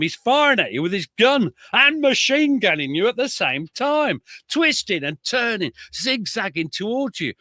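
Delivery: put-on a touch of a Northern accent as you quote the old veteran; Derbyshire veteran voice. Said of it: "He says".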